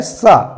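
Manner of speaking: सा drawn out slowly as a hissing s through the teeth; demonstrating the s sound. Shown as a demonstration. Teacher showing students the dental सा slowly. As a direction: stretch सा into a long s. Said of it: "इसको स्वतंत्र ढंग से बोलना है".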